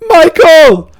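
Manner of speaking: high, loud squeaky shout; slime voice. Retold or imitated. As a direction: yell like a cartoon slime. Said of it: "The slime in the back's like".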